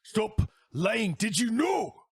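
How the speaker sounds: high shouted accusation. Acted. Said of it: "Lufin confronted".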